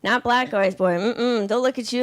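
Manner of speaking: normal talking voice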